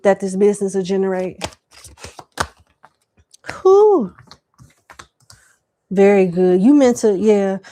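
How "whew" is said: whew. Real, not acted. Reacting to card reading.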